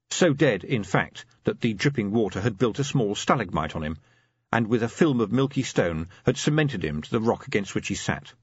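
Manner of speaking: usual speaking voice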